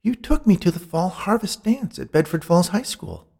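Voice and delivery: high-pitched woman's voice, frustrated; exasperated old flame, high voice; frustrated ghost woman. A narrator's reading. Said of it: "rolled her eyes in frustration".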